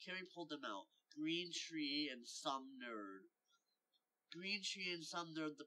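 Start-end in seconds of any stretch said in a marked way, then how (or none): 1.1-3.3 P=A; slow announcement. Acted. Reading out the printed names.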